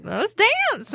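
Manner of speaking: high-pitched hillbilly cartoon voice; hillbilly doll. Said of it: "like, he was like".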